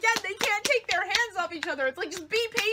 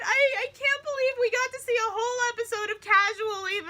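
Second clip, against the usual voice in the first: gushing; 7 semitones higher, faster than usual. Real, not acted. Gushing disbelief.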